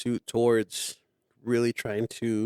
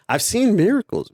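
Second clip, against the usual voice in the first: excited; 9 semitones higher, 4 dB louder than usual. Real, not acted. Excited testimony.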